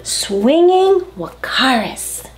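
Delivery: usual speaking voice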